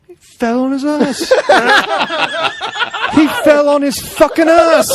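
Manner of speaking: high, laughing through the words; cracking up mid-line; giggling, mock Davey Boy Smith. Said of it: "Davey Boy Smith ... he's like".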